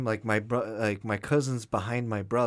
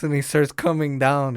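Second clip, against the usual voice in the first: normal talking voice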